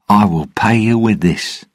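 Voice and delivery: cheerful, confident offer; bright. A narrator's reading. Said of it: "Jack said brightly".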